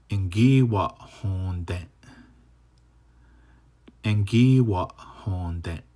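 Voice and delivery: slow, careful "Engi wa honden"; teacher modeling a new word; slow model reading. Shown as a demonstration. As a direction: read it slowly for learners. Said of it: "Next one".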